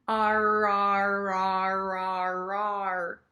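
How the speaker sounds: "R" growled on and on as ar-ar-ar; tiger-like R. Shown as a demonstration. Teacher imagines tigers, roaring drawn-out "R". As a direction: roll continuous "R" sounds like a roaring tiger. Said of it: "I always imagine tigers".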